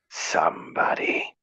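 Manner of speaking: spooky unvoiced whisper; eerie whispered call. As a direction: breathe it into the dark. Spooky game voice lines.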